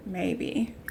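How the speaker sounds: unvoiced, questioning whisper; whispered maybe. Real, not acted. Streamer doubts her meal choice, whispered. Doubtful aside about cooking.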